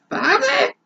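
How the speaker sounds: a very high, stunned Father; hammed-up high gasp of Father; shocked yell. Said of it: "she yelled".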